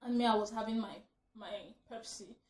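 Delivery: usual speaking voice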